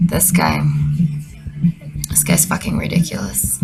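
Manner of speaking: normal talking voice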